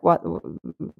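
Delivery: normal talking voice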